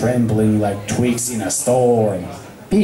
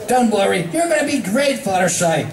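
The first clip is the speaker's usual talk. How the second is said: pep talk; 7 semitones higher than usual. Acted. Rainbow Dash pep talk.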